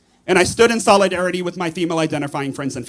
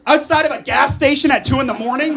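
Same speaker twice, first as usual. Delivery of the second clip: mock rally rant; 7 semitones higher than usual.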